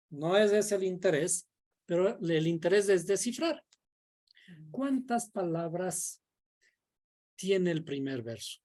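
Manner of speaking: ordinary talk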